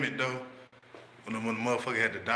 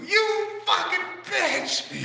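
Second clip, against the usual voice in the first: much higher, louder, very slow screech; mock outraged scream; 5 dB louder, much slower than usual. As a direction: stretch out the insult in hammed-up fury. Friend screams insult.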